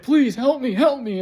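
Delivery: high, loud cry for help; desperate plea. Retold or imitated. Begging the cop for help, desperate. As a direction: beg urgently, pitch shooting up.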